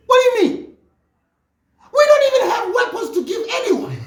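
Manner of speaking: high, loud outraged disbelief; indignant shout. Real, not acted. Ranting at a party's weapons claim, outraged shout. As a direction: yell it like you can't believe the lie.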